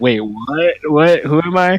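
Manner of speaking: a caught-out player's hammed-up bewilderment; startled confusion. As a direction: sputter it like you just got woken up.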